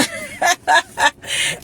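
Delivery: high-pitched happy giggle; excited laugh. Real, not acted. Laughs while signing off excitedly.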